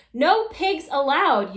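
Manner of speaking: furious sister banning the pig, shouting; shouted. A narrator's reading. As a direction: lay down the law, slowly. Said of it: "Eugenia shouted".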